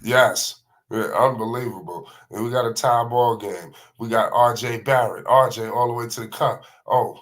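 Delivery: quick, lively sportscaster call of the action; live play-by-play. Acted. Commentator calling LaMelo Ball's Garden matinee, lively play-by-play. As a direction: call every pass like a TV announcer.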